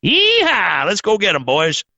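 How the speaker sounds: high yeehaw like a cowboy riding a missile; imitated president riding a missile, whooping. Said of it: "jump on a fucking missile and go".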